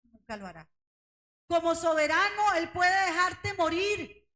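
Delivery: proclama fuerte, a gritos, con pausas lentas en "soberano"; predicación enérgica. Acted. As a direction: truena la promesa ante la congregación. Preaching conclusion at full force. (predicadora proclamando a la iglesia, a toda voz)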